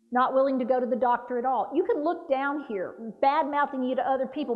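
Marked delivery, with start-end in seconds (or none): none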